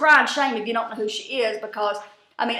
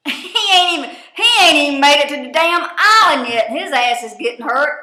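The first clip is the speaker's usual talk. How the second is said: loud, higher-pitched disbelief; exasperated outburst; 4 semitones higher, 9 dB louder than usual. Real, not acted. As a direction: burst out loudly, half laughing in disbelief. Exasperated outburst over the injury.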